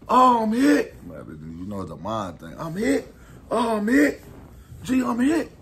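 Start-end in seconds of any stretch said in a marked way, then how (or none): 0.1-0.9 loud, high panicked yell; panicked cry. Retold or imitated. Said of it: "I panic". 2.6-5.5 loud, high panicked yell; panicked cry. Retold or imitated. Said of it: "I panic".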